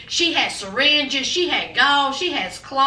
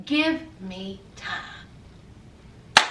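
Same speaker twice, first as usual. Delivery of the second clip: very slow, low and soft; slow quiet daddy; 9 semitones lower, 8 dB quieter, much slower than usual. Retold or imitated. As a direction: drag out the dad's plea quietly. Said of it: "He said".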